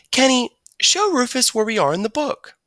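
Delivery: a teacher directing the class; teacher telling Kenny to help the new boy. Acted. Teacher giving an order.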